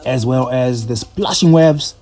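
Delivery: slow, measured travel narration; reflective voiceover. A narrator's reading. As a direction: tell it like a closing voiceover.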